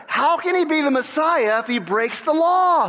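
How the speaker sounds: ordinary talk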